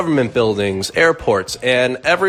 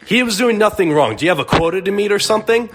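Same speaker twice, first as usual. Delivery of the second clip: higher and fast, protesting the stop; mock indignant dad; 11 semitones higher, faster than usual. Dad defends his son to the cop, indignant and fast. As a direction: fire back at the cop like an outraged father.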